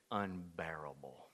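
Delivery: slow hushed whisper; slow confiding whisper. Acted. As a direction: drop to a whisper on the word. Martha warns of the stench.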